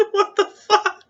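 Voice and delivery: very high, squeaky laughed words; laughing disbelief. Real, not acted. Reader reacting to an absurd joke, cracking up. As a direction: squeeze it out through real laughter.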